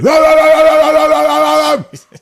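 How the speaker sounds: loud, high, rapid-fire barking noise; frantic mock barking; crazy dog barking. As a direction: imitate dogs going berserk, one long run. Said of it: "the dogs are going crazy".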